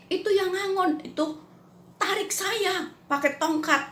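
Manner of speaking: a frail man telling his dream; voicing the dying friend. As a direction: relay his dream in his voice, a touch of wonder. Friend describes the shepherd.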